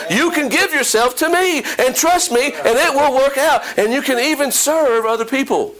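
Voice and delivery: high, urgent preacher voicing God's answer; insistent exhortation. God answering a believer who clings to old ways, insistent exhortation. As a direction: press the point home, pitched well up.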